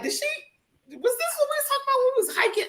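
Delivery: surprised realization. Retold or imitated. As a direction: gasp of recognition mid-read. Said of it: "And then I was like".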